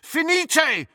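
high-pitched urgent incantation; cried spell. A narrator's reading. Harry casts at the tottering rampart, crying out. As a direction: cry out the spell.

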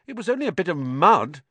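high, loud indignant protest; protesting boy. A narrator's reading. Boy protesting to angry caretaker.

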